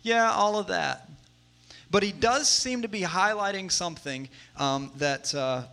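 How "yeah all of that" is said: high, loud "yeah" easing down; comic, high-voiced blanket agreement; noncommittal Paul. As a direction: agree to everything at once, pitched up. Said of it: "like, just kinda say".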